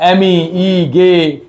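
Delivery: letters of Miguel spelt out very slowly; careful, slow letter-by-letter spelling. Shown as a demonstration. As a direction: dictate each letter slowly. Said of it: "মিগেল বানানটা বলে দিছে".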